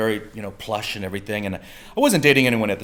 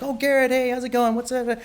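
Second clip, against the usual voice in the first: much higher-pitched chatty greeting; imitated friendly woman; 14 semitones higher than usual. Lucy greeting an old friend, imitated high and chatty. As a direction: voice her cheery hello up high.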